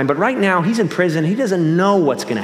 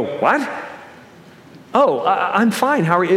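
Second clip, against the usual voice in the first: startled reply; 4 semitones higher than usual. Retold or imitated. Startled reply to stranger's greeting.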